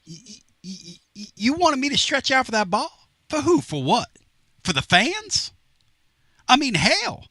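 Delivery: high, exasperated mock outburst; snapping back. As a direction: imitate an annoyed player snapping at reporters. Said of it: "he snaps and he goes".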